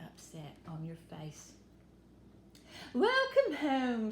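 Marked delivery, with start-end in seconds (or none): 2.9-4.1 slow, loud, drawn-out welcome home; a mother beaming at her daughter's return; warm mother's welcome. A narrator's reading. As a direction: greet her like a doting mother. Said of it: "said her mother".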